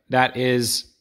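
mock computer voice; robot voice. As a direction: announce like a search bot. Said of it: "the original Google bot".

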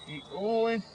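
usual speaking voice

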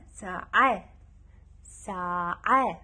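ساعة stretched slowly, the ta marbuta as a long a; stretched saa-a. Shown as a demonstration. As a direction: draw out ساعة ending on a. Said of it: "أضم الضمة". (نطق ساعة ببطء وتنتهي بالألف)

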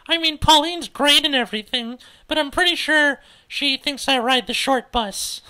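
put-on high, loud girlish gripe; loud, high-voiced teenage griping; exasperated teen complaint. Cordelia complains about Pauline.

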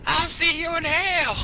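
very high, drawn-out wail; hammed-up high, slow yell; mock anguished cursing. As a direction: curse them like an overacted dying victim. Acted abandoned victim cursing.

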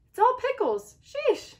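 high, loud exasperated cry. Acted. Shell fed up, finding nothing but pickles, exasperated cry. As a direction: groan it out at all those pickles.

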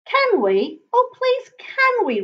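high, pleading little-creature voice; eager high plea; begging child. A narrator's reading. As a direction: beg mother eagerly. Little Raymond begs his mother.

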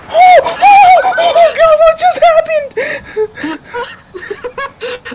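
very high screaming disbelief, dissolving into laughter; scream, then trail into a squeaky laugh; shocked shriek. Real, not acted. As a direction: hysterical high-pitched astonishment. Shocked by sudden explosion.

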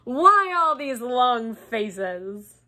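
slow, drawn-out jolly question; buoyant, showy cheer; beaming cheer. A narrator's reading. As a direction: stretch it out with a beaming smile. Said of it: "beaming around him".

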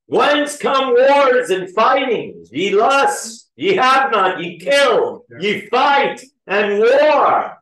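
high, loud, slow preaching of scripture. Acted. Preacher reading James to the congregation, fervent and loud. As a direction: proclaim each short phrase high and slow.

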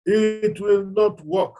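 each word slow and heavy; slow emphatic verdict. Real, not acted. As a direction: lay it down slowly, firmly.

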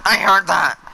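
caught you. Acted. Jealous wife catching the player's whisper, loud. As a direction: snap back at the whisper.

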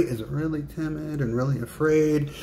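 high, small, timid voice; timid and afraid. Shown as a demonstration. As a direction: shrink into a put-on frightened tone. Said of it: "what is your tone of voice".

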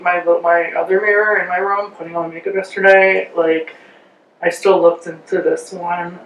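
lips framed by wet mask; wearing a sheet face mask. Real, not acted. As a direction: face movement slightly restricted.

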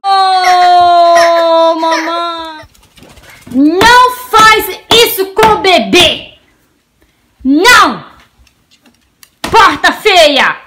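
loud, drawn-out play telling-off; slow, hammed-up outrage; mock scolding. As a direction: scold the naughty door on baby's behalf. Mock scolding the door for baby.

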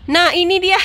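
high, loud ta-da; excited announcement breaking into laughter; gleeful reveal. Real, not acted. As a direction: present it with delight. Reveals the big-headed baby.

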